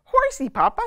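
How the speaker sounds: put-on high, loud little-girl plea; small cub begging her papa for a horsey ride; little Honey. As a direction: beg like a tiny cub wanting a ride. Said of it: "said Honey".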